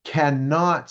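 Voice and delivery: "cannot" slow, each syllable separated; stress on cannot. Shown as a demonstration. Speaker repeating the key word for parents, stressing "cannot". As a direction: spell out "cannot" slowly and deliberately. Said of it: "let me emphasize that word right there".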